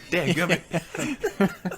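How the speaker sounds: drawn-out, high country cussing; angry country accent. Pretend. Mocking friend's angry side, country accent. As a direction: fume in a hammed-up country accent. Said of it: "get him angry ... a country accent".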